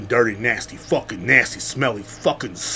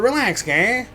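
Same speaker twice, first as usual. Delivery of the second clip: mock Trump; 10 semitones higher, slower than usual. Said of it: "It's like".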